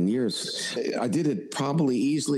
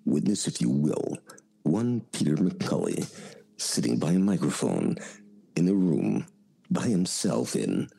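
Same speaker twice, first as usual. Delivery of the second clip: Rod Serling impression; 6 semitones lower than usual. Shown as a demonstration. Voice actor asked for his Rod Serling, low host intro. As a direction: intone it like the Twilight Zone host. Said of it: "I haven't heard your Rod Serling".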